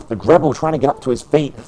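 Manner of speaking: lively wrestling commentator calling the match; play-by-play commentary. Acted. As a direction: call every move as it lands.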